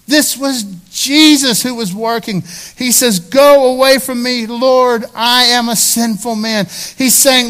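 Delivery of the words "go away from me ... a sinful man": loud, high-pitched plea; crying out. Acted. Peter confessing to Jesus, loud anguished plea. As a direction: beg him loudly to go away.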